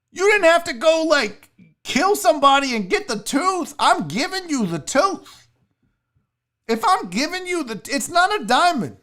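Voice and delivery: high-pitched indignant complaint; worked-up, incredulous ranting; outraged rant. Real, not acted. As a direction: protest the price in high, exasperated disbelief. Outraged rant about the price.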